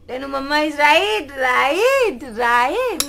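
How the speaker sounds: loud, drawn-out insisting. Real, not acted. Mom insists loudly to her fussing toddler that mommy's right.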